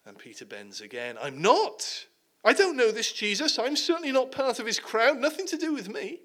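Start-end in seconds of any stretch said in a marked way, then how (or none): 1.2-6.2 rising higher, flustered protest; panicky denial. Acted. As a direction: deny it faster and higher as panic climbs. Peter denies, panicking.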